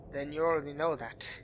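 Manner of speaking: ordinary talk, nothing marked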